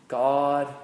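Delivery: ordinary talk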